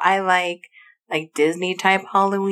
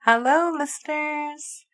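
slow, soft, higher host greeting; put-on host voice; 8 semitones higher, 3 dB quieter, slower than usual. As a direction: lightly copy the intro voice. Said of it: "That was better".

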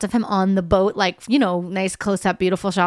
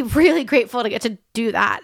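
usual speaking voice

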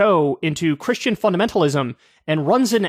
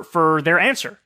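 ordinary talk, nothing marked

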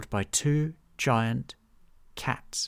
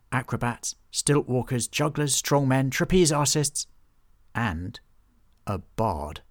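plain reading voice

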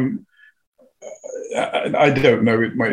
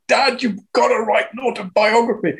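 higher-pitched imitation of pleading children; children's urging; 9 semitones higher than usual. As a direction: quote the kids nagging their dad, pitched up. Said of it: "when I tell them to my children".

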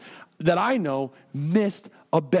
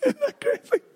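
high, squeaky laugh-talk. Real, not acted. Pastor amused by his own shake joke, high laughing voice. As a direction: laugh right through the words.

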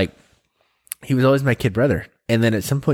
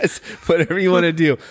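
higher, breezy mock shrug; echoing the wife's careless go-ahead; mock indifferent; 7 semitones higher, faster than usual. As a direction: toss it off like you couldn't care less.

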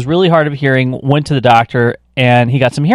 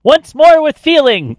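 theatrical director; 13 semitones higher, 3 dB louder, slower than usual. Pretend. Mock director cue.

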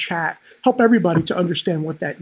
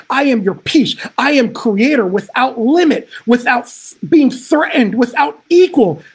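loud, rising sermon proclamation; preaching at full force; 3 semitones higher, 5 dB louder than usual. Acted. Preacher builds to climax.

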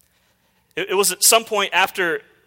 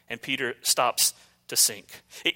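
normal talking voice

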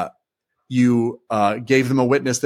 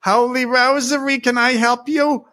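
imitating a thick Jersey accent, much higher, with "Holy Rosary" said as "Howly Rowsary". Parish secretary Louise answering the phone, thick Jersey accent. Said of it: "very thick Jersey accent".

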